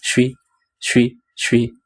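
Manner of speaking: "suis" repeated as a short "shwee"; "suis" with sh at the start, short for je suis; contracted form. Shown as a demonstration. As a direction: drill "suis" as one-syllable "shwee", pausing between. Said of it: "Itu bisa disingkat jadi".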